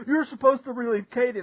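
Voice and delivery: mild mock yell; imitated yelling. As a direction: retell a telling-off without real volume. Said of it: "he's, like, yelling at you".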